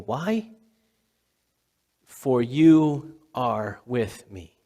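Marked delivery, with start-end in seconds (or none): 2.1-4.6 slow, weighty reading; slow scripture. Real, not acted. Preacher savoring the psalm line, slow and weighty. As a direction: let each word land slowly.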